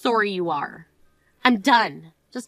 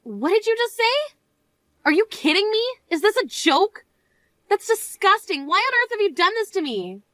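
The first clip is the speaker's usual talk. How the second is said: shocked disbelief; 8 semitones higher than usual. Acted. Bride discovers her ruined dress.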